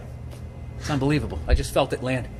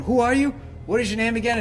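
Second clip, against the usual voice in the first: surprised fan; 9 semitones higher than usual. Retold or imitated. Fan at signing, acted quote.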